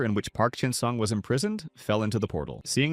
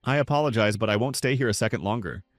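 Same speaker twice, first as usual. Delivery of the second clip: ordinary delivery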